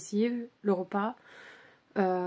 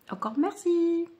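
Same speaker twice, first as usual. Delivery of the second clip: usual speaking voice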